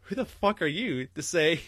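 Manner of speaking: normal talking voice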